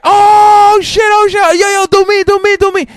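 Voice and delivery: very high, loud excited yelp; laughing customer. Retold or imitated. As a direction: shriek with delight at the joke. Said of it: "and be like".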